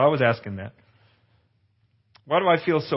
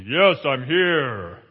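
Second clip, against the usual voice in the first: voice of God; much slower than usual. Retold or imitated. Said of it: "this loud voice say".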